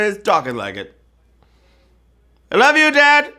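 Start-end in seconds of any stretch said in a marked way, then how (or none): 2.5-3.3 high, loud pretend quote; mock dad voice. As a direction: voice what dad said with his eyes. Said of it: "He said it with his eyes".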